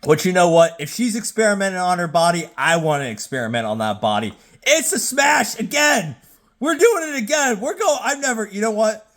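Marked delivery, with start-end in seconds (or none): 4.6-9.1 excited shout. Real, not acted. Excited verdict on rating show.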